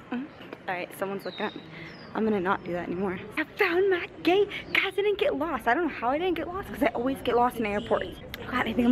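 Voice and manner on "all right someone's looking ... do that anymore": embarrassed low murmur; hushed. Real, not acted. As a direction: drop to a quiet murmur, caught out. Embarrassed, someone watching.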